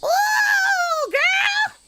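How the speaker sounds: loud, high, drawn-out whooping greeting; calling out. Acted. Housewife spots the man on the motorcycle, calling out high and loud. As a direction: holler a delighted hello, stretching it way up high. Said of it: "she called out".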